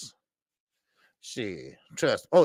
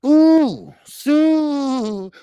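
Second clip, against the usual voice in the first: high, loud, delighted whoop; thrilled shout-out; excited squeal; 11 semitones higher, 9 dB louder than usual. Real, not acted. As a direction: squeal with joy at the donation. Excited reading a super chat.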